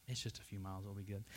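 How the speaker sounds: soft, low brush-off; downplay it under your breath; quiet nervous reassurance. Retold or imitated. Driver hushing worry about the gas light, quiet.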